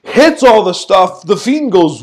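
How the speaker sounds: usual speaking voice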